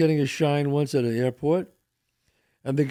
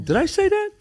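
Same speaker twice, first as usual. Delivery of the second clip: retold indignant comeback; 7 semitones higher than usual. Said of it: "I go".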